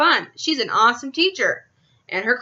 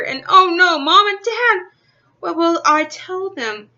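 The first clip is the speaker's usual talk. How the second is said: high, louder worried cry; panicked; 7 semitones higher, 5 dB louder than usual. Acted. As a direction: panic about telling Mom and Dad. Panicked about parents.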